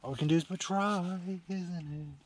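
ordinary talk, nothing marked

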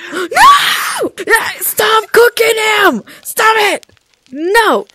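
high, loud frantic yells; panicked shouting. Real, not acted. Begging Kenny not to cook Mister Otterton, frantic shouts. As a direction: scream at him to stop.

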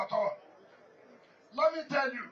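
ordinary talk, nothing marked

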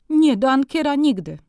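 high, loud and final, punching Nie; emphatic no from a scandalised mother; loud refusal. A narrator's reading. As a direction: she will never go there. Mother refuses the furrier outright.

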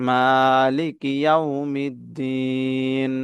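slow মালিকি ইয়াউমিদ্দিন, last "ee" held long; three-alif stretch. Shown as a demonstration. Teacher modelling the verse ending, মালিকি ইয়াউমিদ্দিন slowly drawn out. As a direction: recite মালিকি ইয়াউমিদ্দিন, stretching the final vowel three beats. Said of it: "এখানে মধ্যে আরজি তিন আলিফ টান".

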